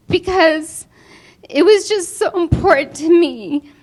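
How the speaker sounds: emotional thank-you choking up; voice breaking with emotion. Real, not acted. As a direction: fight back tears while thanking them. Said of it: "I still get really emotional".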